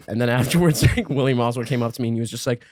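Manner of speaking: ordinary delivery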